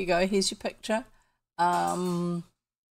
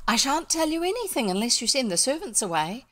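higher, lilting character voice; girl's refusal; 6 semitones higher, 3 dB louder than usual. A narrator's reading. Elfrida insists the servants be sent away, higher lilting refusal. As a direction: refuse firmly with the pitch lifted.

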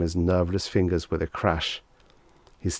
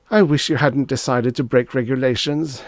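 higher, louder grumble; moody; 6 semitones higher, 5 dB louder than usual. A narrator's reading. Reggie reproaching Death's agent, moody. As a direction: complain with a touch of moodiness. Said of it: "he said moodily".

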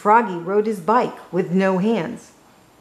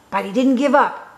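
plain reading voice

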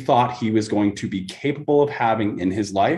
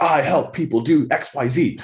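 higher, hammed-up mission-statement voice; mock slogan; 6 semitones higher than usual. Coach mocking a canned mission statement, hammed-up slogan voice. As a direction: parody a cheesy bumper-sticker slogan.

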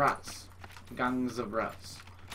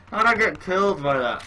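loud, high disbelieving question; raised-voice, baffled protest; incredulous outburst; 7 semitones higher, 9 dB louder than usual. Real, not acted. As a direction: exclaim it in amused disbelief. Killed absurdly, just after laughing.

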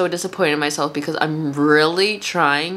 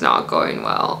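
very low creaky fry voice; vocal fry; slower than usual. Real, not acted. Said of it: "create the fry sound".

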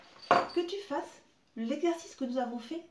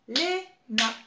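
Léna louder, Lé-na, two beats; syllable by syllable; 2 semitones higher, 6 dB louder than usual. Shown as a demonstration. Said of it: "Deux syllabes". (Léna scandé en deux syllabes)

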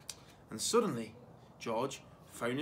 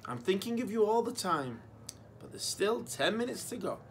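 put-on higher, raised boy's call; calling back; 7 semitones higher than usual. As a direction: call out sweetly to the other room. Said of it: "George called back".

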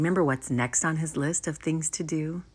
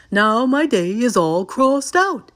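loud, higher, finished-with-it Toad; Toad, satisfied; 5 semitones higher, 7 dB louder than usual. A narrator's reading. Toad crossing out his last item, lightly voiced satisfaction. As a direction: declare it loudly, satisfied. Reads Toad's line in story.

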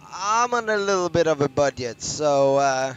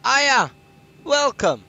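excited greeting; 8 semitones higher, 5 dB louder than usual. Real, not acted.

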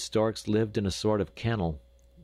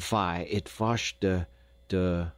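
put-on slow Dutch-accented hesitation on wash de; a Dutch boy groping for the word; sober Dutch accent; slower than usual. A narrator's reading. Jacob searches for the English word in a Dutch accent. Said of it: "repeated Jacob soberly".

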